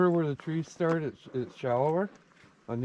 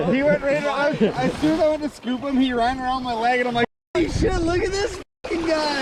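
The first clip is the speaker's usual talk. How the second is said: excited; 8 semitones higher, 5 dB louder than usual. Real, not acted. Excited retelling after landing fish.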